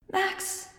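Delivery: high, soft call from far away; a little girl calling faintly inside your head; faint child's voice calling a name; young girl's distant call. Acted. Angel's voice heard in Max's head.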